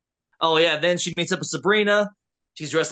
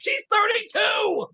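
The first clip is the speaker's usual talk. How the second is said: very high, drawn-out yelp of protest; squealed, slowed-down protest; mock outrage; slower than usual. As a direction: cry it out in comic indignation. Outraged retort at old hag remark.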